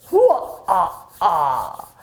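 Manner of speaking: a short gleeful villain cackle; hammed-up trickster laugh. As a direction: rub your hands and cackle. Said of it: "laugh like this".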